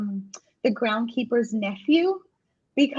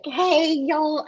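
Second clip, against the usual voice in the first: higher-pitched mock Southern twang; twangy Tennessee accent; 6 semitones higher than usual. Listener imitating the audiobook narrator, twangy Tennessee accent. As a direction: lay on a rough imitation Tennessee drawl. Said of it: "very twangy Tennessee accent".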